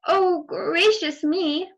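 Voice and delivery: put-on slow, high girlish gasp; high, slow little girl voice; prim little girl. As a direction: clutch your pearls like a proper little girl.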